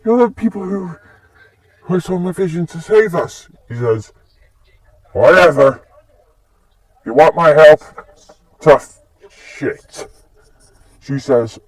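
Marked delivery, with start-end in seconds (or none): 0.0-3.5 put-on high-pitched voice for an amazed girl; awed rabbit girl. Rabbit girl recognises her saviours, high amazed voice. 5.1-10.1 loud, dismissive brush-off; brusque refusal. Acted. He refuses the rabbit girl's plea, loud and dismissive.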